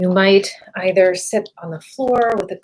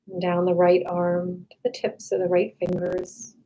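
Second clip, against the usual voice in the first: calm meditation voice with long, restful pauses; guided meditation; 4 dB quieter than usual. Real, not acted. Meditation guide leading listeners to relax the body, calm and unhurried. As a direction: guide them gently through a body scan, settling softer as it goes.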